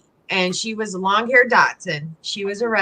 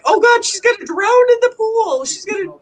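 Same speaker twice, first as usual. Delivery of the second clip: much higher, louder panicky squeal; mock panic; 4 dB louder than usual. Retelling her fear that the blind dog would drown, in a squealing panic. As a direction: replay your own alarm for the dog. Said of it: "And I'm like".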